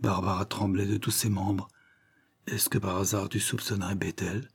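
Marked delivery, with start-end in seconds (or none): 2.5-4.5 softer, hesitant question rising at the end; subdued, uneasy suspicion; hushed question. A narrator's reading. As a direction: ask it under your breath, warily. Trembling sister asks about a suspect.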